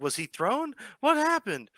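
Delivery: high, panicky questions; panicked crowd. Acted. Onlookers panicking after the fall, high and frantic. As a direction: blurt it out in alarm.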